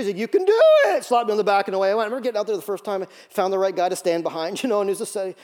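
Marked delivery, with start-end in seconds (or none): 0.2-1.0 high, loud hollered pep talk; coach voice. Retold or imitated. Coach pushes a doubting rookie, high yelled encouragement. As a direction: yell it up high as a caricatured coach. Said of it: "that's how he talked".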